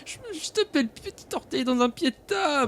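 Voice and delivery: high, tearful whine of pain; crying. Acted. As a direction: whimper it out through tears. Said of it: "pourquoi tu pleures?".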